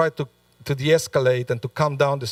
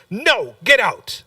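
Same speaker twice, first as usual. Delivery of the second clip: slow, firm dismissal, pitched up; final refusal; 6 semitones higher, slower than usual. Retold or imitated. Host making a final refusal to Bar Kamsa, slow and firm. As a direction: drag out the last order with contempt.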